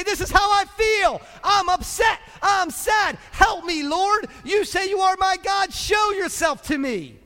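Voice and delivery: high, loud, desperate shouted prayer; furious, pleading cry to God; angry prayer. Acted. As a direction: shout your hurt up at the Lord. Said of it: "pray some angry prayers".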